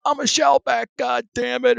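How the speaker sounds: high, loud "shellback" hollered out; proud shout. Retold or imitated. Initiate shouting the right answer out of the engine can, proud yell. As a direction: yell "I'm a shellback" with defiant pride. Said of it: "what you're supposed to say is".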